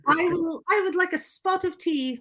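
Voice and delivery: high-pitched mock British; horrendous British accent. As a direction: do a hammed-up caricature of English speech. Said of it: "my British accent is horrendous".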